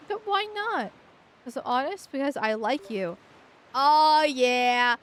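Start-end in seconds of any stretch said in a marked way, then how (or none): 3.7-5.0 loud, slow, drawn-out "oh yeah"; gleeful gloating. Real, not acted. Gamer gloating at the screen, loud and drawn out. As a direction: crow it out like you just won.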